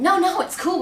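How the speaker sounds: high, loud brushing-off; defensive protest. Retold or imitated. Jazz guy to security guard, loudly defensive.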